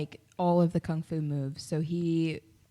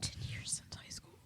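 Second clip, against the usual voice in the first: whispering; 18 dB quieter than usual. Real, not acted. Aside about aging.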